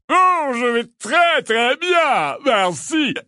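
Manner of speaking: loud, high, drawn-out delight; exuberant. A narrator's reading. Lockhart answers Ron's polite greeting, exuberant. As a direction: gush it in a showy, overjoyed voice. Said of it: "répondit Lockhart avec exubérance".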